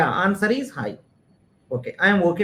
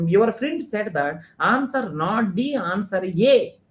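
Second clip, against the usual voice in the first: ordinary delivery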